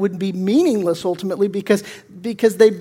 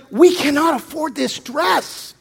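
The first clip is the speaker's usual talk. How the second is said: stern husband; 6 semitones higher, slower than usual. Retold or imitated. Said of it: "He's like".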